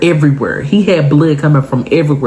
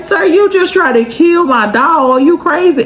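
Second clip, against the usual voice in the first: very high-pitched incredulous scolding; hammed-up outrage, pitched way up; mock indignation; 13 semitones higher than usual. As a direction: scold in a squeaky put-on voice. Mock scolding of the attacking dog.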